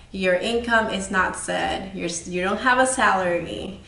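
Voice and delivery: ordinary delivery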